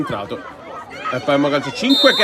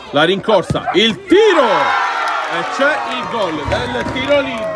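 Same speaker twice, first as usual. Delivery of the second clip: goal shout; 3 semitones higher, slower than usual. Real, not acted. Free kick goal.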